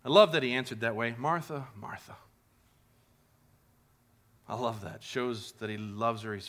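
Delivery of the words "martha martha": gentle repeat. Acted.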